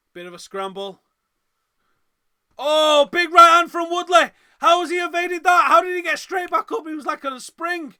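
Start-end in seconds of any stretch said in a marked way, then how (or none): 2.6-7.9 yelling in disbelief, high and loud; excited shout. Real, not acted. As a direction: shout it like a stunned fan. Live fight, huge right hand.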